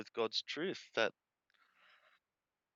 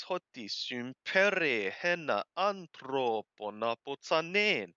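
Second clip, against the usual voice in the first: slow, louder, measured reading of the Greek text; scripture reading; 4 semitones higher, 7 dB louder, slower than usual. Acted. Teacher reading John aloud to the class, slow and measured. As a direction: read each phrase aloud slowly as holy text.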